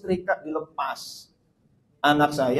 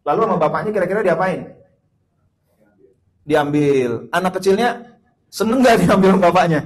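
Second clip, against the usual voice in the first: louder questions to the crowd; rousing call and response; 3 semitones higher, 7 dB louder than usual. Real, not acted. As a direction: quiz the congregation. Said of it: "call and response quiz". (tanya jawab dengan jamaah, lantang)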